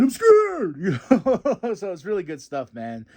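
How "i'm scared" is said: high, loud, cartoon fearful squeak; hammed-up fearful plea; mock scared Tony. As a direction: squeak it out like a frightened underdog. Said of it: "then him saying".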